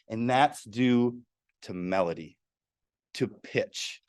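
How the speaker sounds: ordinary talk, nothing marked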